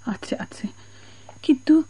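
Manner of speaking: hushed, breathy giving in; whispering so no one hears. Acted. Conceding, then hushed objection. (ফিসফিস করে)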